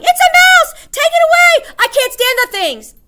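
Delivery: very high shrieking at full force; piercing, hysterical screech; panicked yelling. A narrator's reading. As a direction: shriek it in terror of a mouse. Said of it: "She sprang out of her chair yelling".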